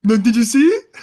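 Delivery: loud, high, eager question; childlike excitement. Retold or imitated. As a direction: burst out proudly, like a delighted kid. Acting out his proud, happy self.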